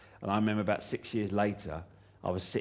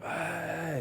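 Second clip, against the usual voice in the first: higher, a mock crowd cheer; lightly imitated crowd, much higher; crowd roar imitation; 8 semitones higher than usual. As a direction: echo it back like a whole audience. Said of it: "and everyone went".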